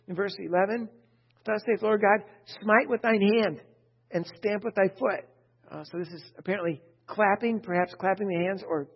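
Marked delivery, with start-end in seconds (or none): 1.4-5.3 louder, higher proclaiming scripture read. Acted. Preacher reading Ezekiel to the congregation, loud proclaiming. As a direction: declaim the prophecy to the congregation.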